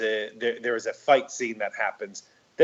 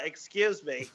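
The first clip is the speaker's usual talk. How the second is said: nerd voice; 4 semitones higher, 7 dB quieter than usual. Retold or imitated. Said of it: "the nerd voice".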